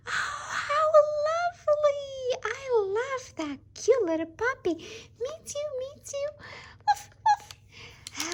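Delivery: very high, gushing doll voice; squealing doll voice. Pretend. Dolls gush over the little puppy, very high and squealing.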